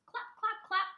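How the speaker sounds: high, bouncy clap clap like hooves; clip-clop sounds. A narrator's reading. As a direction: bounce the words like a trotting horse. Horse hooves clapping, read aloud.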